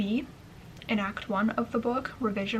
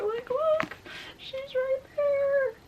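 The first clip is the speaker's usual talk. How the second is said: much higher, slow, awed squeal; thrilled; much slower than usual. Real, not acted. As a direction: squeal with disbelief. Pointing at friend's name, thrilled.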